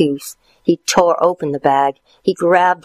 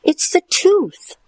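put-on boy voice. Stuart high.

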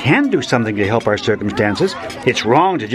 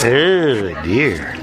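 slow, sinking mock concern; mock dismay; 4 semitones lower than usual. Man teasing girl about her pictures, in mock dismay. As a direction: pretend it's bad news, voice dropping. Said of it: "I'm joking".

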